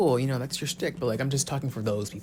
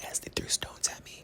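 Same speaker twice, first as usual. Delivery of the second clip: hushed, wounded whisper; soft, mock-martyred hush; melodramatic whisper; 7 dB quieter than usual. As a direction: drop to a theatrical whisper.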